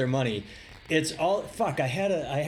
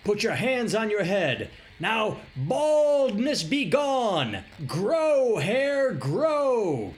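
heal the crowd from the pulpit; televangelist impression; 8 semitones higher, much slower than usual. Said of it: "have you seen his other clip?".

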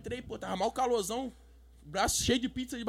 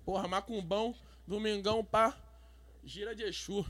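ordinary talk

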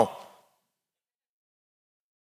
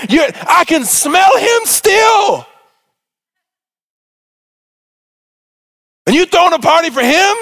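jealous tantrum; 9 semitones higher than usual. Acted. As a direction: rant like a sulking big brother. Older son's jealous rant acted out.

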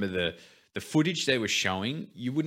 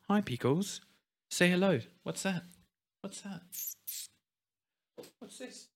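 talking to the dog; 9 semitones higher, 6 dB quieter than usual. Real, not acted. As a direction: coo at the dog, fading quieter.

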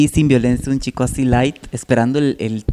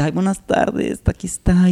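camp light guy; 5 semitones higher than usual. Retold or imitated.

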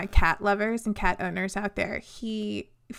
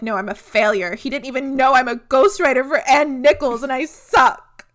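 loud, high, bratty whine; 4 semitones higher, 9 dB louder than usual. Retold or imitated. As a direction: wail it like a dramatic brat. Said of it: "is a fucking brat about it".

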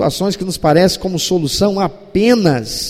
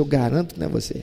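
soft reassurance; 5 semitones lower, 9 dB quieter, much faster than usual. Retold or imitated. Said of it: "olha dentro dos olhos e diz".

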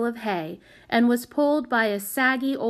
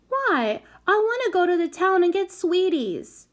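put-on high, insistent small-child voice; little girl; 10 semitones higher, 3 dB louder than usual. A narrator's reading. Small child insisting on joining the trip, high-voiced. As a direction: plead high like a determined little kid.

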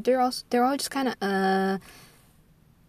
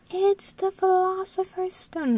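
high-pitched, slower mocking quote; mock correction; 11 semitones higher, slower than usual. People telling the speaker the book's name, mock correction. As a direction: mimic a pedant in a high, drawn-out voice.

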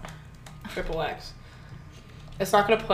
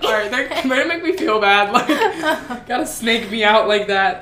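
mock hurt protest; 3 semitones higher, 8 dB louder than usual. Teller protesting being made to feel bad, mock hurt. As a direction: play up being wounded, half laughing.

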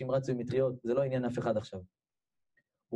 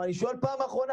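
high, pressing last warning; insistent demand; 10 semitones higher, faster than usual. Retold or imitated. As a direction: push the question as an ultimatum. Giant's final warning in retold standoff. (דרישה נחרצת)

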